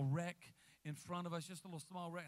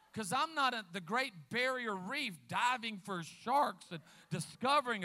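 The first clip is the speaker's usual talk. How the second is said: full-force preaching; 5 semitones higher, 7 dB louder than usual. Acted. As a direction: preach it hard at the crowd.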